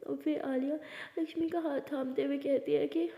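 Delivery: plain reading voice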